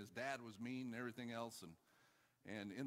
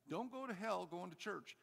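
higher, quicker-moving repeat; insistent repeated warning; 7 semitones higher than usual. Real, not acted. Preacher repeats warning after "did you hear me?", higher and insistent. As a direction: drive the warning home in a higher pitch.

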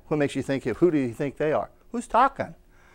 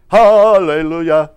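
loud hallelujah; 10 dB louder, slower than usual. Real, not acted. Preacher exclaims to congregation.

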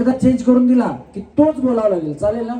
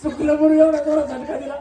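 higher, laughing through the words; laughing tease; 5 semitones higher than usual. Real, not acted. Host laughing at a contestant who jerks her head while saying the twister.

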